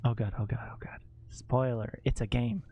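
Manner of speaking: hushed whisper through the tense scene. Real, not acted. Streamer whispers to chat during an intense scene, hushed. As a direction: keep it breathy and hushed, barely voiced.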